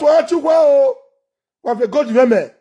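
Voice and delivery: ordinary talk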